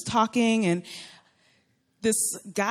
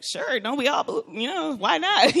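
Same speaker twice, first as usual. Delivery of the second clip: light, higher-pitched jokey agreement; joking it off; 6 semitones higher than usual. Retold or imitated. Woman deflecting a man's marriage question, joking it off. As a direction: brush it off playfully, pitch lifting. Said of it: "joking it off".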